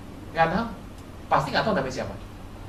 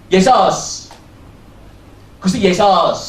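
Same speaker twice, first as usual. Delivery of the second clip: Yesus and Gusti Yesus said the Javanese way, louder, like "Yesos"; Javanese pronunciation; 8 dB louder, much slower than usual. Shown as a demonstration. Preacher showing how Javanese say Yesus, louder. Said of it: "Orang Jawa ngomong Yesus".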